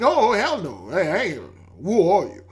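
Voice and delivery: loud, indignant mock parent; outraged refusal. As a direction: bark it back like a shocked parent. Parents refusing a stranger outright.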